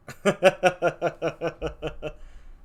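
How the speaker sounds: long, even ha-ha-ha laugh; staged laugh. Acted. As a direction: laugh theatrically in a steady rattle.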